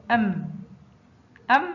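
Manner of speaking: أم closed on a hummed m; letter with sukun. Shown as a demonstration. Teacher shows mim with sukun, أم closed on m. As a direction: say أم as one isolated closed syllable. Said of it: "حرف الميم فوقه سكون ينطق".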